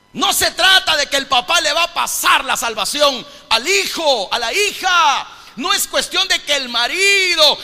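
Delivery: high, shouted pulpit proclamation; loud, impassioned preacher; full-force preaching. Real, not acted. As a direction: drive every phrase home to the congregation.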